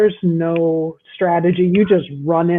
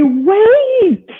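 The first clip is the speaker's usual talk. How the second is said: mock yell, pitched way up; retold yell; 14 semitones higher, 5 dB louder than usual. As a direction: yell it high, imitating a gaming partner. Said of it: "Andy's yelling at me".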